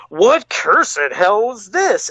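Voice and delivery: very slow shocked villain line. Acted. Reading the villain's shocked line aloud, a very slow, dramatic voice. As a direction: stretch out each word in mock horror.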